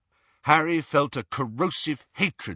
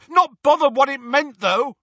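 loud, higher, sneering retort; angry retort; 8 dB louder than usual. Acted. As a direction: bite back at him. Ron cuts Harry off angrily.

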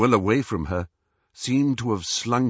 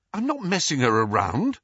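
higher-pitched defensive retort; indignant denial; 7 semitones higher than usual. A narrator's reading. Harry answers Ron's accusation, indignantly. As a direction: push back, voice raised in pitch.

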